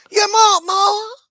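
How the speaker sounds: high, loud, slow taunting shout; mock Trump offer. Trump offering Netanyahu weapons, high hammed-up shout. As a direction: dangle it like a prize.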